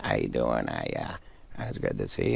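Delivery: low, mumbled Sandler impression; a drowsy low-voiced Sandler greeting; mumbling Adam Sandler. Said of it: "he just kinda mumbles".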